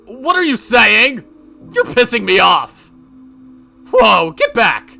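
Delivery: loud, high, furious protest; angry outburst. Acted. Yoshimura accused by Mion, shouting angrily. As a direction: lash out at your accuser.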